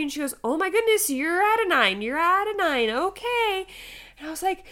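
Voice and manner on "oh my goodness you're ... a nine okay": high, loud, amazed nurse; retold astonishment. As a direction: announce startling news, acted and high-pitched. Nurse's surprised discovery, retold.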